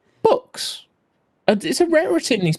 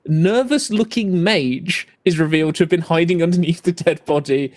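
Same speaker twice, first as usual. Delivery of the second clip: usual speaking voice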